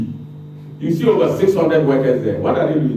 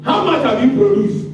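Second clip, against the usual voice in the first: ordinary delivery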